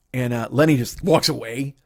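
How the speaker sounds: normal talking voice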